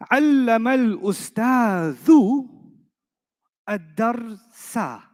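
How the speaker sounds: slow careful Arabic reading; Arabic example read slowly. Shown as a demonstration. Teacher reading the first sentence to the class. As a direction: read it out word by word. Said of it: "example sentence read slowly".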